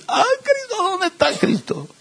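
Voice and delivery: very high, soft, hammed-up sobbing plea; mock weeping. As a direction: fake a tearful cry for Christ, way up high and quiet. Mocking exaggerated emotional seeker.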